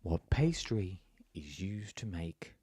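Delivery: slow, low MasterChef-style narrator; slow, low cookery-show question; MasterChef man voice. Real, not acted. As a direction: pose the question like a MasterChef voice-over, slowly. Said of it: "I sound like the man on MasterChef".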